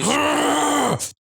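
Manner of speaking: glitching, slowed and high-pitched; corrupted audio. Real, not acted. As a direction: voice warped by a broken stream. Audio glitch mid-stream.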